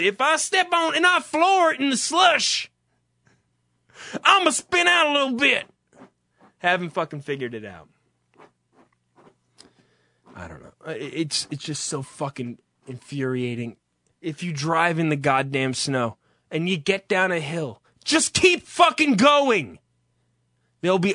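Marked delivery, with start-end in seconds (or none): 0.0-5.7 raised-pitch caricature of a truck owner; mock clueless driver. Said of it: "they haven't figured out that". 18.0-19.8 high, slow, emphatic outburst; exasperated command. Real, not acted. Exasperated advice to timid drivers.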